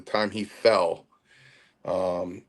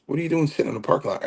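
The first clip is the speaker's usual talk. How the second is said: mock Chief, fast; 6 semitones higher, much faster than usual. Chief questioning him on phone.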